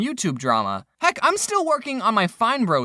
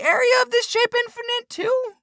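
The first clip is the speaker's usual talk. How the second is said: high squeaky voice. Acted. High far-off mock question.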